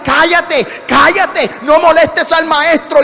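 loud, high, urgent hushing; scolding disciples. Acted. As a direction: shout down a nuisance. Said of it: "los discípulos que estaban allí decían".

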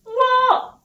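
very high, loud cry of disbelief; exclaiming boy. Acted. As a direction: squawk it like an astonished boy. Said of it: "Exclaimed the boy".

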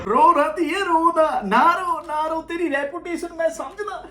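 high, drawn-out fake sympathy; mock consoling. Reactor tells the complainer not to cry, mock consoling. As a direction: soothe him like a weeping child, making fun.

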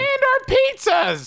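outraged squeal. Acted. Outraged about stolen pizzas.

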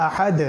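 আহাদ with the final "d" bounced, "ahad-e"; qalqala echo. Shown as a demonstration. Teacher shows reading at a stop, echoed "d" in আহাদ. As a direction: stop on আহাদ and let the "d" rebound. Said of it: "কলকলা করে".

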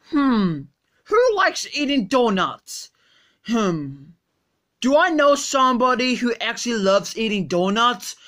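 normal talking voice

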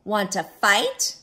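loud, slow, rising challenge; storybook bully itching for a scrap; grouchy ladybug. Acted. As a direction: dare them, stretching the last word. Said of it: "said the grouchy ladybug".

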